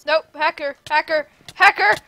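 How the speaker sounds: rising shout, hacker hacker; alarmed shout. Real, not acted. Spotting a hacker, yelling.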